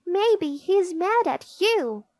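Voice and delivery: high-pitched little sister's retort; child accusing. A narrator's reading. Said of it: "said D W".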